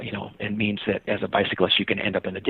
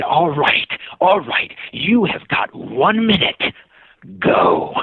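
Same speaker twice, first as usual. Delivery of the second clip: acted exasperation; 6 semitones higher, 3 dB louder, much slower than usual. Cyclist finally grants the kid his minute, mock exasperated. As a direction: spell it out slowly, fed up, then drop onto "Go".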